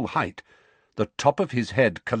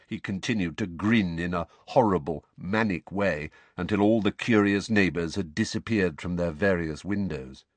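ordinary delivery, nothing marked

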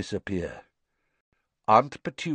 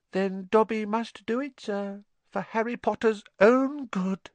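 high, pleading elf voice; squeaky house-elf; 11 semitones higher than usual. A narrator's reading. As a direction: beg like a timid little elf.

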